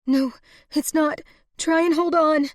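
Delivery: high, loud, insistent plea; desperate urging. A narrator's reading. Woman begging dying lover to live, high and desperate.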